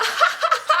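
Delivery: very high mock cackle; put-on high staccato laughing; imitated hard laughter. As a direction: cackle like teasing relatives. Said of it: "They was laughing so hard".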